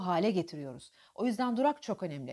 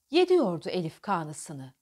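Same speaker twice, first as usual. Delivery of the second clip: poetry recitation; 3 semitones lower, 3 dB louder than usual. Shown as a demonstration. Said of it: "Tekrar okuyalım şimdi".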